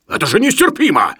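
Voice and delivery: high, loud exasperation; indignant outburst. Acted. Professor scolds Sharikov over the havoc, outraged. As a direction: explode with indignation.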